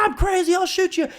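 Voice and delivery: high, frantic mock yell; crazed threat. Friend faking madness to buy time, crazed. Acted fake crazed gunman distraction.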